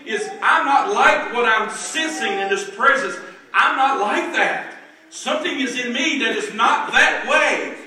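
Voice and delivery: high, insistent pulpit voice; fervent preaching. Acted. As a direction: build into loud, emphatic declarations. Preaches at full force.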